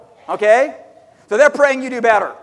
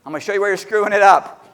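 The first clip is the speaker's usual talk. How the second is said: fast throwaway repeat; faster than usual. Shown as a demonstration. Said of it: "repeats line".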